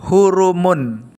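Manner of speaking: hurumun, slow, every syllable clear; hurumun said carefully. Shown as a demonstration. Teacher showing the joined reading hurumun to a pupil, slowly. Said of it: "Saat dibaca wasol".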